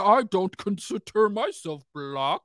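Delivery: slow, prissy put-on voice; mocking celebrity voice. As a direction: mimic a sellout disowning his roots. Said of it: "and be like".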